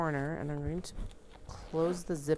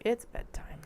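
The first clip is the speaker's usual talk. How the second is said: whispering; 7 semitones higher than usual. Retold or imitated. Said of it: "to be like".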